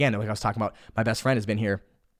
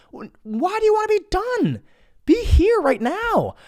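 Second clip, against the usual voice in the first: much higher, slow chiding voice; inner voice; slower than usual. Retold or imitated. His inner voice telling him to slow down, high and slow. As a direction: scold yourself like a patient sage.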